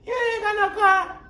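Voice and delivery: very high mock mom voice shouting; angry mom. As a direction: play the suspicious mother from the doorway. Mother voiced in story.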